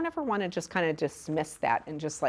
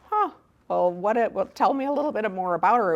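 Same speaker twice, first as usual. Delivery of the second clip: higher-pitched, mildly intrigued response; curious; 5 semitones higher, 3 dB louder, faster than usual. Acted. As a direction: draw the child out with gentle interest. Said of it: "being curious about something".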